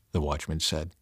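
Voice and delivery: usual speaking voice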